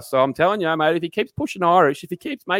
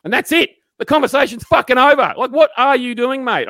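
higher and louder, fed up; exasperated rant; 8 semitones higher, 4 dB louder than usual. Real, not acted. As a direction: vent at a small-talk offender. Ranting about pointless small talk.

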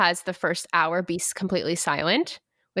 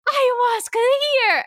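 very high, stretched-out holler; mock ecstatic shout, slow and high; imitated yell; 5 dB louder, slower than usual. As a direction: announce an arrival at full cry.

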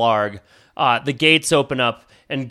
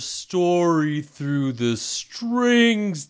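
NPC voice; much slower than usual. Acted. Bard NPC keeps talking.

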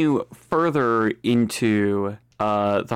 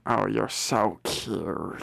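slow, soft, low rasp; cooing witch; 4 semitones lower, 6 dB quieter, slower than usual. Acted. Witch admires the cute visitor, creepy slow coo.

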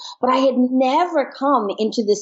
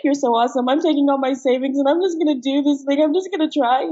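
imitated eager message; 5 semitones higher than usual. Acts out her messages to Russell.

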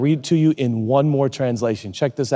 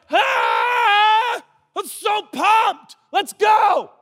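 high-pitched, hammed-up excited shouting; mock exuberance; 3 dB louder, much slower than usual. Preacher playing a man just healed, hammed-up excitement. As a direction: yell it like you've just been healed, drawn out.

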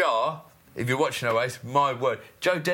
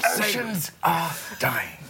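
Attenborough impression; 2 semitones higher, slower than usual. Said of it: "a bit David Attenborough".

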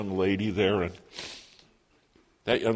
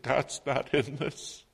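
higher, drawn-out, upset words; retold tearful despair; 6 semitones higher, slower than usual. Crying son to his mother after her fall, retold tearful despair. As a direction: give up, as if near tears. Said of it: "I'm crying, she's crying".

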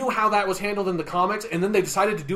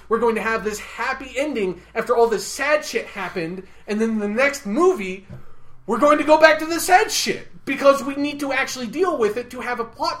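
mock studio voice climbing higher and louder; mocking the filmmakers; 6 semitones higher, 4 dB louder than usual. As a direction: parody the writers, getting more worked up. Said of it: "They're just going to be like".

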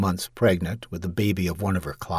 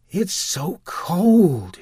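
very slow, higher shivering voice; 11 semitones higher, 5 dB louder, much slower than usual. Acted. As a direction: shiver through the words.

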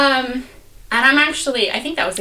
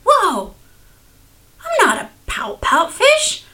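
delighted surprise; 10 semitones higher, 5 dB louder than usual. Retold or imitated. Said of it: "then he's like".

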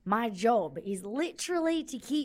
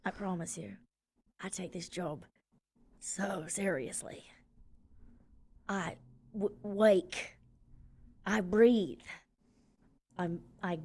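mock sincere; 7 semitones lower, 5 dB quieter than usual. Mock earnest in-character vow.

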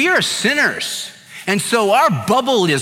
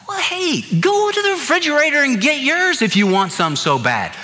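incredulous; 7 semitones higher than usual. Retold or imitated. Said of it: "I was kind of incredulous".

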